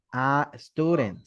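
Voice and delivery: slow, stressed a student; slowly stressing the article; slow emphasis. Shown as a demonstration. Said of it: "siempre va a ir".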